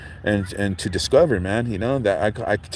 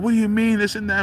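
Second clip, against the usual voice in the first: higher heated challenge; mock outrage; 11 semitones higher than usual. Man protests his pass card, high mock anger. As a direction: retell his angry challenge. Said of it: "he's like".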